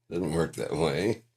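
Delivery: normal talking voice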